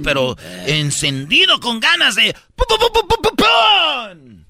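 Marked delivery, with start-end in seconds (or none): 2.7-4.5 imitated bursts. Imitated sound.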